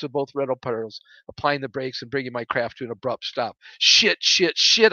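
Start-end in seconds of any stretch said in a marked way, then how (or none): 3.8-4.9 loud, higher-pitched burst of swearing; yelled curse. A narrator's reading. Pilot alone in cockpit with failed steering, yelling. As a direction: yell it out in frustration. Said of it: "I yelled aloud".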